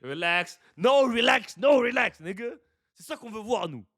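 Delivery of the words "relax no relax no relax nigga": retold on-court "relax", building louder; mock player's "relax", building from a lightly voiced start to a loud, high protest. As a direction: act out the player waving off trash talk, getting louder. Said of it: "Rick Owens, il a dit".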